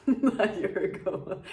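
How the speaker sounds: laughing through the words; half-laughing, rueful. Real, not acted. Joking about past drinking.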